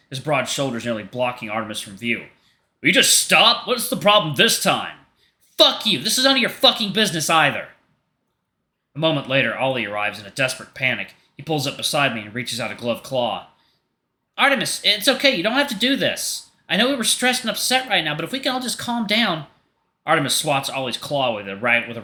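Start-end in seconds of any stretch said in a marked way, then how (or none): 2.8-5.0 higher, louder exasperated demand; exasperated confrontation. Acted. Hunter shielding Leo from Artemis, exasperated loud demand. Hunter stepping between them. 5.6-7.7 panicked angry shout. Acted. Artemis shouting at Leo and Hunter over the license, high furious yell. 14.4-19.5 high, louder, anxious pleading; desperate calming plea. Acted. Ollie begging Artemis to calm down, high anxious plea.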